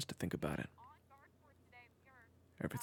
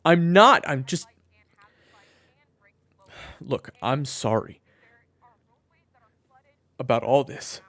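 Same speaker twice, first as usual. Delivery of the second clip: much louder and higher, cutting in; loud protest; 7 semitones higher, 16 dB louder than usual. Acted. Man protesting to his brother, loud and high. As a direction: shout him down, then apologize still loud. Defending himself in the argument.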